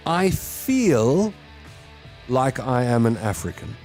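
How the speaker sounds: ordinary talk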